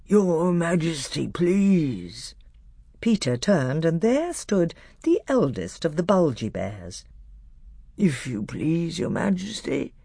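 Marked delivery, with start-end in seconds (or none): 0.0-2.4 slow, drowsy bear; thick, sleepy voice. A narrator's reading. Bear petitioning the High King, thick and sleepy. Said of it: "a thick, sleepy voice said". 8.0-9.9 thick, sleepy voice. A narrator's reading. Bear petitioning the High King, thick and sleepy. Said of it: "sleepy voice said".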